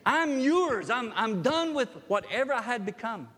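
loud, high cry of surrender. Retold or imitated. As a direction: give yourself up fully. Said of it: "he was saying".

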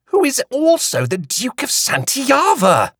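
high, slow, knowing tone; Queenie's voice. A narrator's reading. Queenie informing Hattie, a touch superior. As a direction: savour the grand title. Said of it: "Queenie said".

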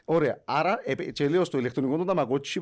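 ordinary talk

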